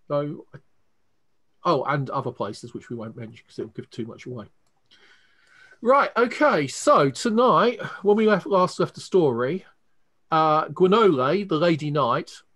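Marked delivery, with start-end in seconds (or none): 5.8-12.4 higher, louder story-opening voice; game master's recap. Real, not acted. Game master opening tonight's story for the players, raised recap voice.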